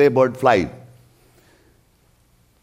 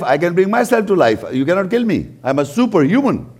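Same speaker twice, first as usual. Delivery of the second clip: ordinary talk, nothing marked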